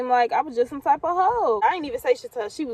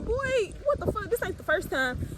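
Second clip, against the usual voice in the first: much higher, disbelieving comeback; incredulous retort; 8 semitones higher than usual. Retold or imitated. She answers the boyfriend's gun threat in high disbelief. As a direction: scoff at him like he can't be serious.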